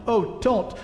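high, urgent plea, stretched out at the end; pleading shout. A narrator's reading. Edmund begging the witch to spare them, shouted plea. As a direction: cry out to stop it, pitched well up. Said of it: "shouted Edmund".

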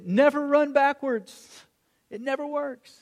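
high, drawn-out sideline instruction; acted coach's holler; coach calling out. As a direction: slowly call it out to the kids.

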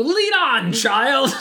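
hammed-up heroic "lead on"; mock grand command. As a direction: announce it like a chosen-one prophecy. Grand prophecy line retold.

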